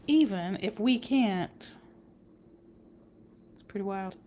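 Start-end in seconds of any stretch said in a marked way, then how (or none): none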